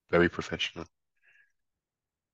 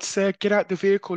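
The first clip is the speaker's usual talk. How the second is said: polite officer; 6 semitones higher than usual. Retold or imitated.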